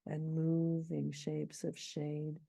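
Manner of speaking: slow, beat marked; scanning the meter. Shown as a demonstration. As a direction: beat out the iambs. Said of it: "three iambs preceded by a trochee".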